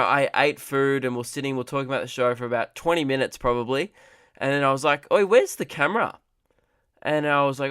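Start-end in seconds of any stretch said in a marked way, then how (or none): 5.1-6.2 raised pitch, sudden mock alarm; retold alarm. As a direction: call it out like you've just noticed a loss. Sudden realisation camera is missing.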